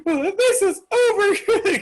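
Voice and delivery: very high, drawn-out wail; hammed-up tearful squeal; mock bawling. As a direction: wail it like a teenage breakup. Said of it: "like, bawling".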